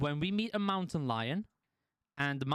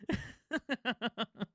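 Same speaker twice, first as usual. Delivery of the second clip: real laugh; 7 semitones higher than usual. Real, not acted. Laughing at courtroom uproar.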